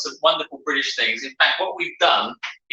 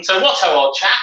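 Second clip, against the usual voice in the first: playfully hammed-up British accent, pitched higher; 6 semitones higher, 4 dB louder than usual. Podcast host greeting co-host for a British episode, very British accent. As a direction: greet them in jolly old-chap British English. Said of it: "With my very British accent".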